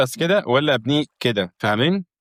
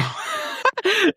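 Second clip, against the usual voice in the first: laugh. Real, not acted. Laughing at ugly house.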